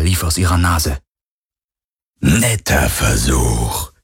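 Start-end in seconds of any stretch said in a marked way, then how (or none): 2.2-3.9 low, very slow ghostly voice; menacing phantom. A narrator's reading. The phantom mocks Garland's bluff, low and slow. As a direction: let it drip with dark amusement.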